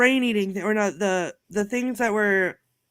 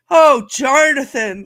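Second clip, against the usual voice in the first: slow, weary, louder "Oh, Jarnathan."; exasperated sigh; 4 semitones higher, 9 dB louder, slower than usual. Retold or imitated. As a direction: drag out "Oh, Jarnathan." like a tired clerk. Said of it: "the way that, that she said it".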